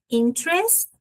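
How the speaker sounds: "interest" with the first syllable lifted high; word base shown. Shown as a demonstration. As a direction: model "interest" as IN-trest, high on IN. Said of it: "eu vou colocar essa mesma base".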